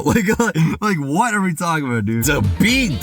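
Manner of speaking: ordinary delivery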